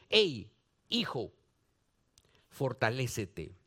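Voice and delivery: low, soft fatherly encouragement; tender fatherly voice. Acted. Paul tenderly urging his son Timothy, low and soft.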